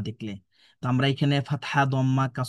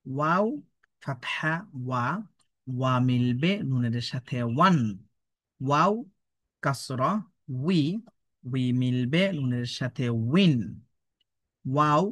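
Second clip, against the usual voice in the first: drawn-out chant rising on joined syllables; spelling drill recitation; slower than usual. Shown as a demonstration. Teacher models waw joined with noon, drawn-out drill recitation. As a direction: stretch each spelling step deliberately.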